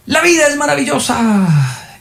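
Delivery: voz muy aguda con las vocales alargadas; proclámalo lento y agudo, como el maestro exaltado; grito alegre imitado. Retold or imitated. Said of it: "es una gritadera". (pregón eufórico, arrastrado y agudo)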